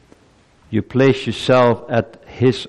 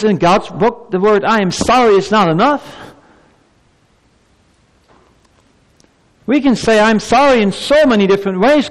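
very high, insistent pulpit voice; impassioned preaching; 10 semitones higher, 4 dB louder than usual. Real, not acted. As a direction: drive it home in ringing, raised phrases. Preaches at full force.

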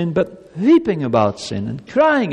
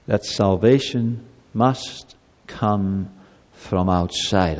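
solemn preaching; 7 semitones lower, slower than usual. Acted. Pastor reminding the congregation, solemn slow voice. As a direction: lay it down gravely, word by word.

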